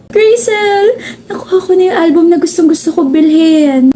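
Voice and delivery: high, bright burst of excitement calling a friend; excited call. Acted. Excited friend showing off a new album, thrilled call. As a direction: shout her name, thrilled to bits.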